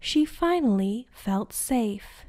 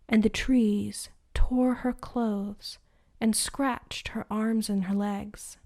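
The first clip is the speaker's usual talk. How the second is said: plain reading voice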